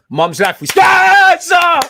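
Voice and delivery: very high, loud yell; a fan yelling at the players in the heat of the moment; excited shout. Real, not acted. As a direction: scream it at the telly. Shouting at the chance.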